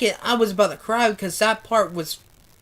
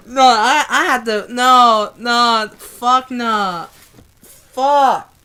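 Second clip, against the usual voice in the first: loud, raised, tearful refusal; distraught protest; 4 semitones higher, 7 dB louder, much slower than usual. Real, not acted. Reader who had to stop the book, distraught outcry. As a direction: cry out in real upset, breaking into fragments. Overcome, near tears after reading.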